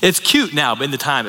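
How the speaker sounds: ordinary talk, nothing marked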